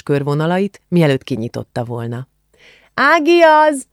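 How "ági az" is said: high, loud shout toward the living room; shouting back. A narrator's reading. Julcsi shouts back to her aunt, high loud call. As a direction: yell it back through the house. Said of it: "kiabált vissza a nappaliba".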